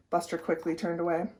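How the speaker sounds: ordinary delivery, nothing marked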